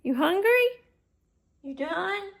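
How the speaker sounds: very high, loud doggy voice; pet talk. Real, not acted. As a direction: owner asking the dog if it's hungry, high pet voice. Asking dog about food.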